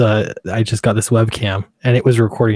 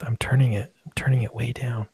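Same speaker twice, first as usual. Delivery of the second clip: softer, dropping to a whisper; talking really quiet; 7 dB quieter than usual. Real, not acted. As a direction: hush it right down so the mic doesn't clip. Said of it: "I'm talking really quiet".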